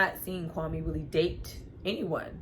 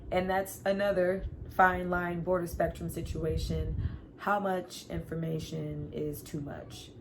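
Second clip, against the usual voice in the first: ordinary talk, nothing marked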